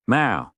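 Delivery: high, sudden cat cry; loud cat meow. Acted. Cat pesters owner in comfy chair, loud meow. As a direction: meow sharply for attention. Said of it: "Blimey, that was loud".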